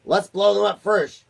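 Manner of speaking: loud, high gleeful blasting noises; eager war cry. A narrator's reading. Army chief begs to blow them up, loud and gleeful. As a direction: relish every bang. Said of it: "cried the chief of the army".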